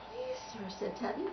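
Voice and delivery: very soft, high plea; meek protest. Acted. Tubby answering the conductor, meek and soft. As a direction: shrink to a tiny, timid voice.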